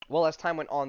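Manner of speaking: ordinary talk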